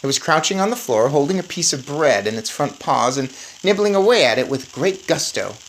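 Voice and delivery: usual speaking voice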